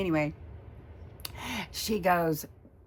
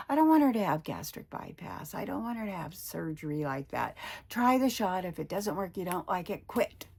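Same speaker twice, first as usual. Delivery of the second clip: ordinary talk, nothing marked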